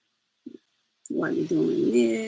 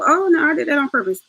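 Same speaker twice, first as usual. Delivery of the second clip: mock defensive; 7 semitones higher, 3 dB louder, faster than usual. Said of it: "trying to make it go".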